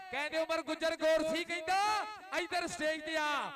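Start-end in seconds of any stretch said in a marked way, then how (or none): none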